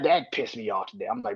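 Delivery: usual speaking voice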